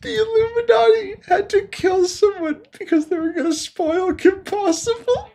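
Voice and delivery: high voice choked with laughter; laughing disbelief. Real, not acted. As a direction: wheeze it out in amused disbelief. Laughing at absurd theory.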